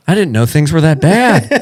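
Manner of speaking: high, loud mock disbelief; mock shock. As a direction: gasp it out in fake alarm. Mock incredulous quote.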